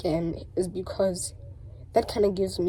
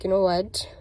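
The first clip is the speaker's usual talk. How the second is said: ordinary talk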